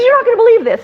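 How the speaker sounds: high, loud-edged retold excitement; excited news. Woman phoning her friend with news, excited. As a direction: burst out with it, pitched high.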